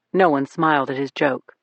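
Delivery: usual speaking voice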